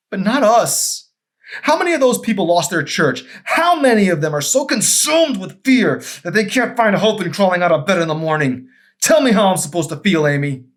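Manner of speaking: very high and loud, begging her; husband pleads against divorce; desperate plea. Acted.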